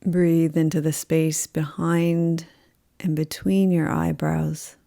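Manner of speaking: slow, calm yoga nidra voice with long pauses; guided meditation. Acted. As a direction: guide them unhurriedly into rest, leaving space after each phrase.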